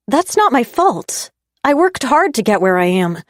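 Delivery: heated. A narrator's reading. Heated defense in quarrel.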